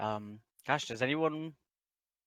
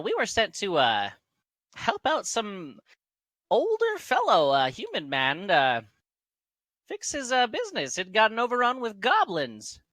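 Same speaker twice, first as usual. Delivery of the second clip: put-on high halfling recounting an old job; Garth; 10 semitones higher, 4 dB louder than usual. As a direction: keep it piping, with halting hesitations. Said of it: "he continues".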